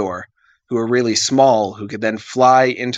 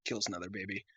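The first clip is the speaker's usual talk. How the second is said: ordinary talk, nothing marked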